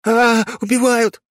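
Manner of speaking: slow high terrified yell; panicked scream. Acted. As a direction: scream for help. Boy screams under knife.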